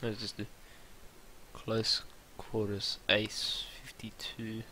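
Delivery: soft slow whisper. Real, not acted. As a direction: breathe it slowly under your breath. Gamer picks weapon.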